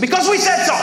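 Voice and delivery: high-pitched mock parent retort; exasperated parent. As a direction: imitate a parent shutting down questions. Parent answering a child's why.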